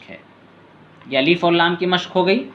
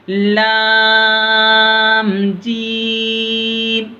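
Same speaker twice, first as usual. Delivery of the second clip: slow, high, louder chanted lam alif; drawn-out recitation; 6 semitones higher, 4 dB louder, much slower than usual. Shown as a demonstration. Teacher demonstrates the lesson's letters to pupils, slow chanted and louder. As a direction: hold लाम very long, then a falling अलिफ. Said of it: "आवाज़ नीचे की तरफ जाना".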